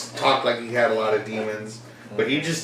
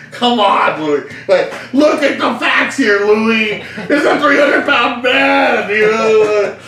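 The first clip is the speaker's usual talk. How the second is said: high, loud laughing mock lecture; lecturing aunt; 9 semitones higher, 6 dB louder than usual. Aunt lecturing young Louis that wrestling is fake, high and loud. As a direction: lecture the nephew like a know-it-all aunt. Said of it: "Mine was my aunt".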